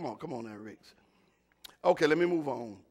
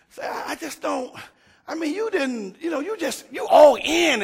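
choked-up high voice, struggling for words; overwhelmed; 12 semitones higher, 10 dB louder than usual. Real, not acted. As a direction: pitch climbs as the feeling overwhelms him. Preacher overwhelmed, rising pitch.

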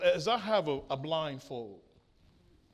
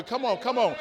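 normal talking voice

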